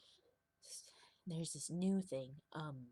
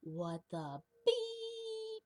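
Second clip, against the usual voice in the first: very high, drawn-out squeaky "What the beep?"; a cartoon TV censor voice, stretched slow and squeaky; TV bleep voice; 4 dB louder, much slower than usual. In his head when something goes wrong, squeaky high. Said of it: "me just going like".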